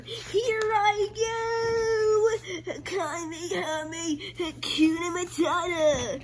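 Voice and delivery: very high, strained battle cry; anime attack scream. Acted. Hero unleashing the final attack, high straining battle shout. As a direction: scream it like a full power-up.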